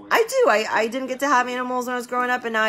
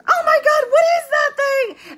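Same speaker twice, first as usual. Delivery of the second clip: very high, loud, panicked shriek; imitating a mom screaming at a hamster; hammed-up shrieking mom; 7 dB louder, slower than usual. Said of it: "And she was like".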